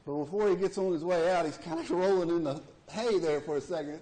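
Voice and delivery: normal talking voice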